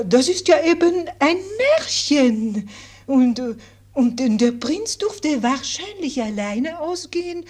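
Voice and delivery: put-on gentle governess with French accent. French governess tells fairy tale.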